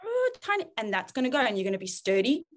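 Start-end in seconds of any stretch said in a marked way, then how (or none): 0.0-0.7 put-on whiny child. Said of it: "might still go".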